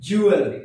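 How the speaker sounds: "জুয়েলারি" said as "JU-el-ri", no "la"; correct pronunciation. Shown as a demonstration. Teacher giving learners the proper form, "জুয়েলারি" as JU-el-ri. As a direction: say "জুয়েলারি" running l straight into "ri". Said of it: "কি হবে?".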